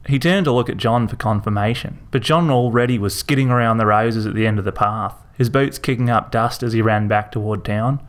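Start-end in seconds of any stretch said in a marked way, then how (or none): none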